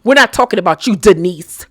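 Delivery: usual speaking voice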